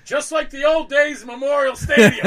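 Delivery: high, loud and cracking up through it; laughing punchline. Retold or imitated. Golfer recalling his comeback to Bill, laughing. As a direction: deliver the comeback while laughing.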